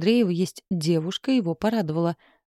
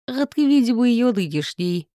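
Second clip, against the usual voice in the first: a mocking, knowing jab, higher and louder at the start; smirking jab; 5 semitones higher, 4 dB louder than usual. A narrator's reading. Romka needling Max about Sveta with a smirk. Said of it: "хмыкнул Ромка".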